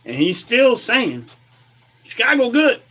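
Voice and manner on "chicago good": high mocking voice imitating the mayor; mock him in a high voice.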